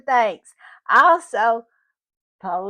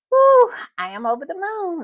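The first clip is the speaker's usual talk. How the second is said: higher and louder, bursting with joy; overjoyed; 7 semitones higher, 5 dB louder than usual. Real, not acted. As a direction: gush with real delight. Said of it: "Let me calm down a little bit".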